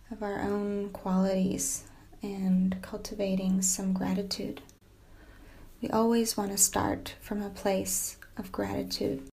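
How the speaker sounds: soft yoga nidra voice with long pauses; guided meditation. Acted. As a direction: gently settle the listener into stillness, speaking softly.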